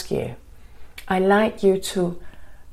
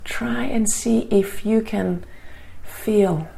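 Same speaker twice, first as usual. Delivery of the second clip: guided meditation. Acted. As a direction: ease them into calm, gently.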